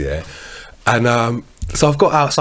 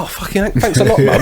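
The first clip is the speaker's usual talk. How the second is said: higher, laughing mock indignation; sarcastic thanks through a laugh; retold wounded comeback, amused; mock indignant; 6 semitones higher, faster than usual. Retold comeback to mum's verdict.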